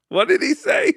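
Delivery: squeaky high incredulous What did he say; mock disbelief. Host shrieking at a misspoken phrase, incredulous. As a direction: shoot the pitch way up, loud and baffled.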